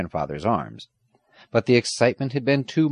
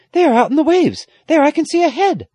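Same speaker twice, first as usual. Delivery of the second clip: put-on very high, loud girlish excitement; crying out; 9 dB louder than usual. A narrator's reading. Girl sees a head in the waves, crying out. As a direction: call out the sighting, high and urgent. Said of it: "Aggie cried".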